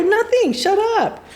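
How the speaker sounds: high-pitched brush-off, retold; mock defensive denial. Sister caught out in the living room, defensive. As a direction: act out denying it, voice jumping up.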